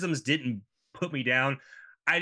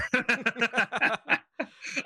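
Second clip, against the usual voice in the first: high, sustained real laugh; real high giggling laughter; 9 semitones higher than usual. Real, not acted. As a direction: burst out in a high-pitched laugh. Laughing at own Andromeda joke.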